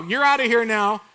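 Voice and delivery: usual speaking voice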